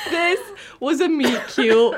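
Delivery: laughing. Real, not acted. Teasing laughing claim.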